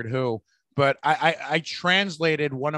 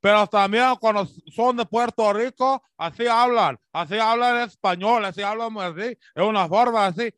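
higher, louder Puerto Rican Spanish accent; 5 semitones higher, 4 dB louder than usual. Shown as a demonstration. A Puerto Rican accent demo for a comic, higher and louder. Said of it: "the way they pronounce".

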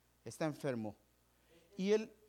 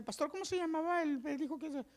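high, hammed-up voice of a forgetful member; mock forgetful church member; 12 semitones higher than usual. Pastor mimicking a congregant who forgot the doctor's name, high and mocking. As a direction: play a flustered congregant asking for a name.

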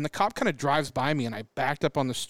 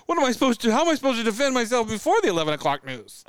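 pitched up, louder, joking indignation; mock indignant; 8 semitones higher, 4 dB louder than usual. Joking to a friend, mock indignant high voice. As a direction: play up the silly outrage.

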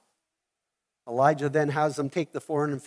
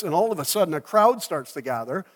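usual speaking voice

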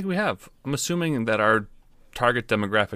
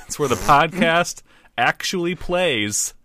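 slow, louder mock teaching; real simple explanation; 7 semitones higher, 4 dB louder, slower than usual. Fed-up trainer explaining to everyone, slow condescending simplicity. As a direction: spell it out very slowly, emphatically. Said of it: "make this real simple for everyone".

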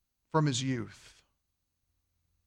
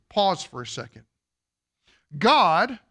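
ordinary talk

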